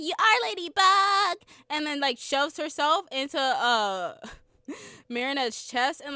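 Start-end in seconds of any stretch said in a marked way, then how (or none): 0.0-1.4 high, loud mock Tiki declaration; imitating Tiki's loud proclamation; mock heroic shout. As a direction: announce it like a pushy magic sidekick. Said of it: "She's like".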